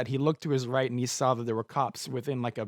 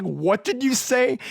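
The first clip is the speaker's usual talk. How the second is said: menacing; 10 semitones higher, 6 dB louder, slower than usual. Retold or imitated. Thug confronts him.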